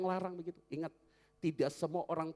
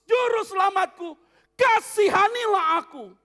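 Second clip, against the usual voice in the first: high, loud, slow desperate shout; blind man's cry; 21 dB louder, much slower than usual. Acted. Blind Bartimaeus shouting to Jesus, loud and pleading. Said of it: "Maka dia mau bicara begini".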